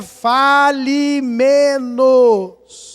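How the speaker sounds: very slow, weighty "Fale menos"; preacher's slow emphatic command; drawn out. Acted. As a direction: lay each word down slowly. Preacher stresses Bible's advice.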